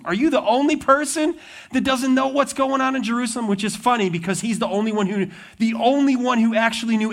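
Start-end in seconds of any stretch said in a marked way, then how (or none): 0.0-3.5 high-pitched, incredulous question; mock disbelief at a clueless stranger. Disciples' incredulous question, acted.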